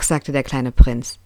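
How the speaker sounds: ordinary delivery, nothing marked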